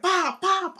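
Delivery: high mock punch noises; punch sounds. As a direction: pop them out like blows landing. Imitating punches.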